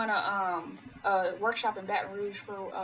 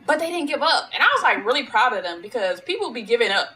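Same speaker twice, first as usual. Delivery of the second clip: excited; 3 semitones higher, 8 dB louder than usual. Real, not acted. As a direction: cheer them on, beaming. Excited praise of students.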